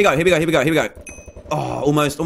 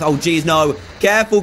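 ordinary talk, nothing marked

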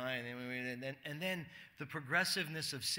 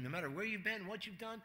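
ordinary talk, nothing marked

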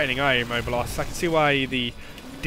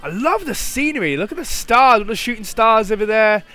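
delighted; 6 semitones higher than usual. Real, not acted. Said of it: "I'm not paying attention to the fight".

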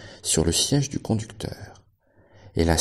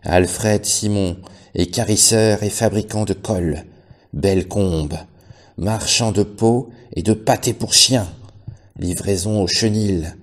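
loud slow reading; 2 semitones higher, 5 dB louder, slower than usual. A narrator's reading. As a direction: read out the sign slowly and loudly. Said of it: "il lut".

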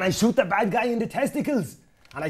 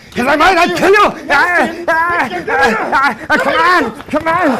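hammed-up fight cries; 7 semitones higher, 9 dB louder, slower than usual. Mock fight, Arnold roaring.

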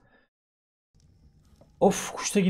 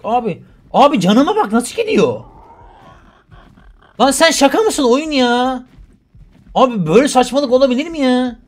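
exasperated rant; 8 semitones higher, 7 dB louder than usual. Real, not acted. Ranting at game.

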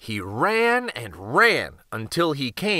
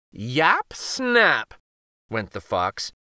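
usual speaking voice

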